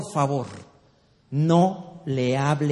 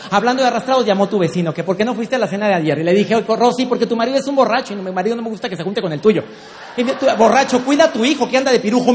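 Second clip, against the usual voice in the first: rushed nagging wife; 2 semitones higher, much faster than usual. Retold or imitated. Wife bursts out at her husband at the door, rapid and rattled. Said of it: "Sale la mujer, pero volada".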